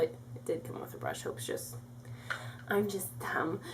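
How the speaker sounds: whispered self-mocking aside; whispering. Real, not acted. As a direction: drop to a whisper. Self-deprecating aside under breath.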